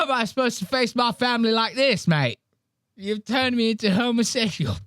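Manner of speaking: high-pitched mock-anguished Australian lament; lost Australian man. Comic mimics James facing his family, mock-distraught Australian. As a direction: bewail it like a stricken Aussie. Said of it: "He looks like the most lost man".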